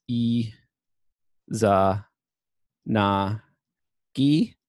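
E, Za, Na, Gi as separate syllables with long pauses. Shown as a demonstration. Lecturer reading characters for their sound, syllable by syllable. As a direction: read out E ... Za ... Na ... Gi, one sound at a time. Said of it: "So this is".

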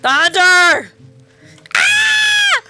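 loud, high frightened shrieks; real terror, yelled high; panicked screaming. Real, not acted. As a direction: scream and beg as the hunter closes in. Hunter chasing her in game.

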